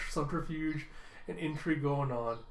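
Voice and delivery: usual speaking voice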